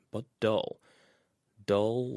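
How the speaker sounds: usual speaking voice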